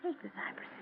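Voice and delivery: breathy dying whisper; ghostly whisper. Acted. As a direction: hiss the dead man's curse. Quoting the dead man's last words.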